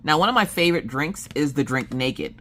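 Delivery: ordinary delivery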